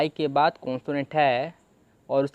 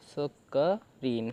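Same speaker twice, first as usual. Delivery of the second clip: स क रीन drawn out, softer; slow sounding out; 4 dB quieter, much slower than usual. Shown as a demonstration. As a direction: blend स क रीन slowly and quietly. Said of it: "ऐसे आपका screen बन गया".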